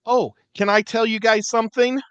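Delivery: ordinary talk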